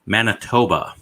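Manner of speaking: ordinary delivery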